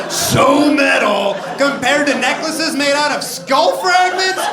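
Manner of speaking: high, loud and slow, climbing to a yell on fragments; mock outrage. Comic ridiculing the list's order, in a building mock yell. Mocking the ranking, rising outrage.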